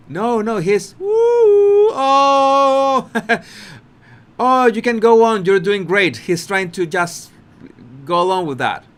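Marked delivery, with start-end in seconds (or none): 1.0-6.3 louder, pitched-up fake encouragement; hammed-up "go on, you're great"; mock cheer. Colbert mock-reassuring Harry, loud and higher.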